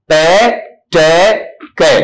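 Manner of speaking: पे, टे, कै as short, clipped, separate stop sounds; sound demonstration. Shown as a demonstration. Teacher demonstrating stop sounds for students to repeat, one by one. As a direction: spit out पे, टे, कै one by one with a pause after each. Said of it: "तीन sound आपसे वहां पर बुलवाऊंगा".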